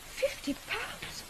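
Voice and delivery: astonished gasp. Acted. Shocked at too much money.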